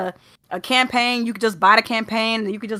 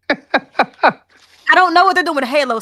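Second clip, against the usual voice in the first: laughing; 5 semitones higher, 5 dB louder, slower than usual. Real, not acted. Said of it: "Sorry, I don't know what they're doing with Halo".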